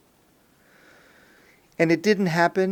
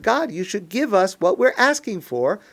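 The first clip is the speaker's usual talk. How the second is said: higher, forceful plea to God; insistent demand; 4 semitones higher, 4 dB louder than usual. Shown as a demonstration. Worshippers telling God to give what they ask, insistently. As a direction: press God to hand it over. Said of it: "but that's not exactly what we say".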